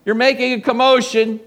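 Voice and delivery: loud, high-pitched scolding; crowd shushing someone. Acted. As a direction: tell off a noisy man over the din. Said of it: "Everyone's telling the guy".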